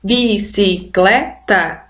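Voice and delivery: bicicleta stretched into slow syllables, bi-ci-cle-ta. Shown as a demonstration. Teacher counting syllables for her students, slow syllables. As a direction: tap out each syllable of bicicleta. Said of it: "quatro vezes".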